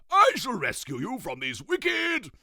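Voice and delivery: shouted, pompous and high; loud royal proclamation. Acted. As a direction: proclaim it like a grand king. King Toothbrush Weasel proclaims.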